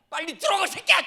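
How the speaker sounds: put-on high, loud yell at a kid; half-laughing mock scream at the son; mock furious yell. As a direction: bellow it like an angry mother. Mother scolding son, shouted quote.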